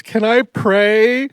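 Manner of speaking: high, slow, loud mock whine; imitated crying. Crying Yuvi asks coach to pray, mock tearful plea. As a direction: plead like a tearful little kid. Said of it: "he's just crying and crying and crying".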